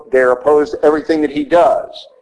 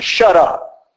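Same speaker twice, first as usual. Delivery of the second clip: curt rebuke; 2 semitones higher than usual. Retold or imitated. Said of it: "to be colloquial about it, he says".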